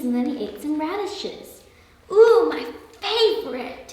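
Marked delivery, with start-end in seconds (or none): 2.1-3.9 delighted, higher and louder, drawn out; happy storybook delight. A narrator's reading. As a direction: savor it with happy relish. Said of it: "he said happily".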